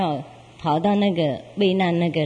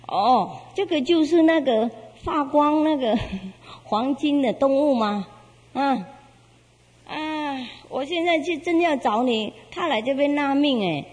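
surprised inner thought; 7 semitones higher than usual. Acted. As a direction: voice jumps higher in surprise. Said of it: "在那边自己想".

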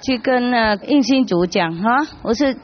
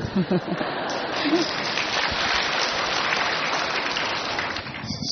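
long soft laugh; 2 semitones lower, 9 dB quieter than usual. Real, not acted. Exchanging thank yous.

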